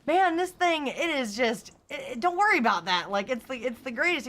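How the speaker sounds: high, loud bragging dodge; mock car owner. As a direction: brush the question off like a proud owner. Imitating BMW owner dodging question.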